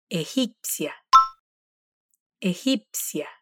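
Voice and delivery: egipcia with a rasping throat g, softly spoken; Spanish G sound. Shown as a demonstration. Profesora: egipcia con la ge raspada, en voz baja. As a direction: scrape the g of egipcia in the throat, quietly. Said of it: "la pronunciamos como hacemos con la R de inicio en portugués".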